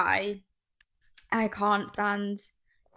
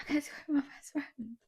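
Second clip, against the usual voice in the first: mocking whisper; 2 semitones higher, 7 dB quieter than usual. Mocking friend's boast.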